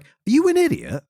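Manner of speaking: very high, incredulous question; mock incredulity. Reader challenging a dim character, high mock incredulity. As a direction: pitch it right up in mock disbelief.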